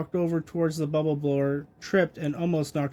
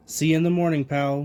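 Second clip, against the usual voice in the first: ordinary delivery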